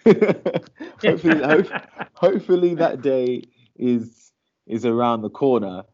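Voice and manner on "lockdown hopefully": high laugh breaking through words. Real, not acted. Host laughs at own slip.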